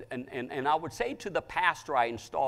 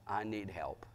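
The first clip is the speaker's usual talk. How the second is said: whispered, low plea; hushed plea; 6 semitones lower, 10 dB quieter than usual. Acted. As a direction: repeat it as a soft, helpless whisper. Woman's desperate plea, repeated.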